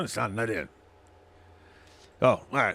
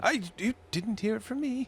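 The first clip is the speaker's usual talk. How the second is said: secretive barmaid; 13 semitones higher than usual. Acted. Barmaid NPC confiding.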